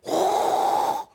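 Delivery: imitated roaring rush of wind; loud wind whoosh. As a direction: blow a loud, voiceless whoosh like a wind tunnel. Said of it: "like a real loud".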